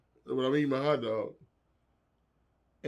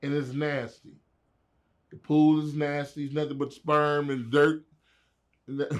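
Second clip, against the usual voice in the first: ordinary talk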